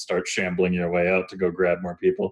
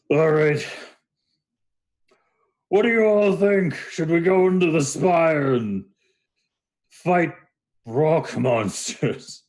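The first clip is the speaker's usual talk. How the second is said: raised, high Minotaur voice; drawn-out rallying question; calling to the group; 9 semitones higher, slower than usual. Acted. Minotaur calls out to the blacksmiths.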